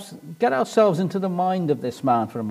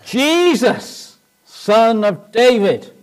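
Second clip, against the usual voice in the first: loud high booming call; high, loud plea shouted far; crying out; 7 semitones higher, 8 dB louder, much slower than usual. Acted. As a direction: call out over a crowd, drawing the words out. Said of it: "he cries out, doesn't he".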